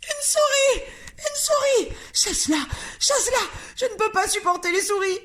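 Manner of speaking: very high shrill panicked screams; screaming. A narrator's reading. Mrs Jenkins screaming at her husband about the mouse, high and panicked. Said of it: "en hurlant".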